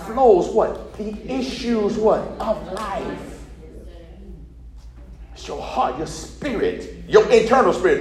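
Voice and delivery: usual speaking voice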